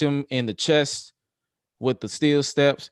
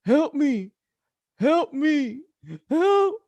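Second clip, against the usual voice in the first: very high, strangled "Help me" over and over; imitated cry for help; 4 dB louder, much slower than usual. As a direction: squeak like a man in a chokehold. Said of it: "that's literally what he was like".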